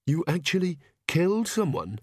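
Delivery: mildly stunned character voice; shocked disbelief. Acted. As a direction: ask it aghast, stressing the horror. Agnes appalled at the killing.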